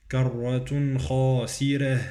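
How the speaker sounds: slow recited "خاسرة" with thin r; light ra. Shown as a demonstration. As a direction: draw out "خاسرة", keep the r light. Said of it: "الراء مرققة في كلمة خاسرة".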